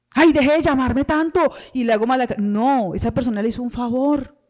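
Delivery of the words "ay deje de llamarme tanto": mock annoyed debtor, raised and loud; irritated snap. Pastor mocking an annoyed debtor, loud irritated snap. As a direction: snap at the caller in a put-on voice. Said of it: "yo antes me pongo bravo".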